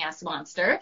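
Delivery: ordinary delivery, nothing marked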